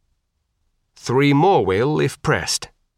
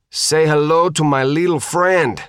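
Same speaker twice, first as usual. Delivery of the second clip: shouted; 5 semitones higher, 3 dB louder than usual. A narrator's reading. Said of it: "she shouted".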